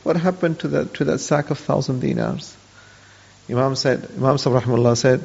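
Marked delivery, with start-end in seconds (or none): 0.0-2.5 sheepish, much softer ask; shamefaced half-mumbled question; quietly sheepish and a bit cheeky; cheeky, embarrassed. Retold or imitated. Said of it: "in a very kind of cheeky and very kind of embarrassed voice".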